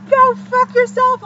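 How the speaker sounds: high, loud, drawn-out mock scream; imitated angry yell. Imitating a stranger yelling after her as she leaves, angry. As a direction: stretch it out, hammed-up and shrieky.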